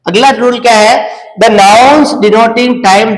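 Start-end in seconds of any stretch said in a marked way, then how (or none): 1.4-3.2 each word drawn out slowly, very slow; slow classroom dictation of the rule. Shown as a demonstration. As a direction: read it out as if for students to copy down. Teacher reads rule heading aloud.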